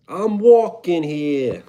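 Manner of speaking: slow, drawn-out New York accent on walking. Shown as a demonstration. Streamer doing New York accent for a chat request. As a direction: hit the classic New York "I'm walking here". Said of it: "Yes, New York accent".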